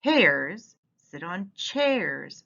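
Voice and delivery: "Hares sit on chairs" drawn out very slowly, each word stressed; slow it right down to show the rhyme; slow emphatic reading. Shown as a demonstration. Teacher modelling the bolded rhyme for kids, very slow. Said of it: "so can be read this way".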